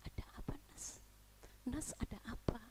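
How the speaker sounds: hushed voice of Jesus; whisper. Acted. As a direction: whisper it close, as if into an ear. Voicing Jesus whispering to John. (berbisik pelan seperti Yesus)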